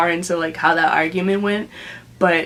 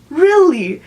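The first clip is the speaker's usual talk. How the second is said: high, loud incredulous "Really?"; disbelieving really; 8 semitones higher, 6 dB louder than usual. Retold or imitated. Girls react in disbelief.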